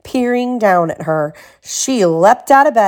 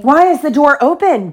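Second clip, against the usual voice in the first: raised, demanding bear voice; lightly gruff bear, pitched up in alarm; gruff Daddy Bear; 7 semitones higher, 4 dB louder than usual. A narrator's reading. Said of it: "Daddy Bear said in his deep, gruff voice".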